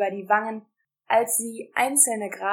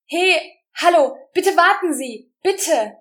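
high, loud desperate call; calling after; 8 semitones higher, 10 dB louder than usual. A narrator's reading. Rosa calling after the departing leader, loud high cry. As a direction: shout after them as they leave.

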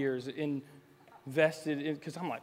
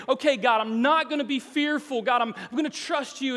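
loud vow; 9 semitones higher, 9 dB louder than usual. Retold or imitated. Believers' vow, voiced loud.

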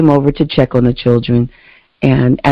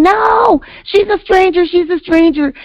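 mock kids screaming; 14 semitones higher than usual. Kids refusing a stranger, high frightened mock scream. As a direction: squeal it like a scared kid, mock scream. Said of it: "they started screaming".

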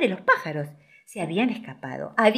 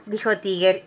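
ordinary delivery

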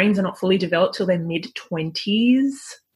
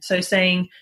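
ordinary talk

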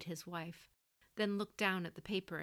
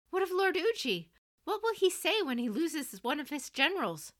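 put-on much higher, louder, pleading young voice; erupting protest; 10 semitones higher, 8 dB louder than usual. Son protesting to his mother, high and loud. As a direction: blurt it out in alarm. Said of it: "Protests he could not stifle erupted".

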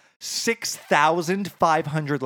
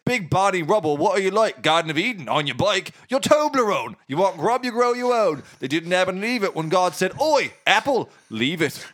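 high-pitched, hammed-up Cockney patter; Cockney accent; 5 semitones higher, 3 dB louder than usual. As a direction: rattle off rhyming slang in broad Cockney. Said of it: "Cockney, Cockney".

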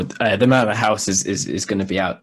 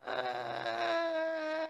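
breaking up, high squeaky laughing; helpless laughter; 14 dB quieter than usual. Real, not acted. Players cracking up over the fart joke. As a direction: laugh until the words squeak.

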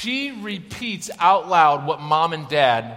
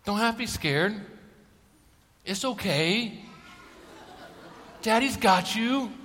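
lightly voiced toddler reassuring herself; a hint of a little girl's voice; 4 semitones higher, 5 dB quieter than usual. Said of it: "looks over at me and says".

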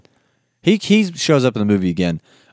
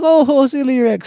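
much higher, fussy voice; flustered waiter; 3 dB louder than usual. Retold or imitated. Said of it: "he's like".

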